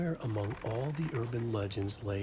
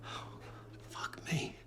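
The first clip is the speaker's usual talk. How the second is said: whisper; 8 dB quieter than usual. Acted. Stunned by the news.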